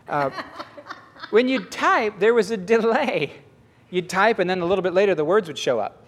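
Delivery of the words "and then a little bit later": usual speaking voice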